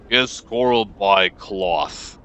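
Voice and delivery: very slow, word by word like a ticking clock; flat, measured merchant. Acted. Trembling merchant tells a customer his trade, flat and measured. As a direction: tick out each word evenly. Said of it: "voice ticks like a clock, flat and measured".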